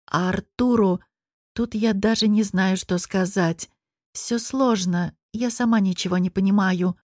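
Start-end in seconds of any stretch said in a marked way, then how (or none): none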